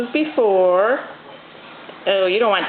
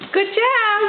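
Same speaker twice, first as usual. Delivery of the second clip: high praise; 9 semitones higher than usual. Real, not acted. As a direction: praise her up high and bright. Praising baby's steps.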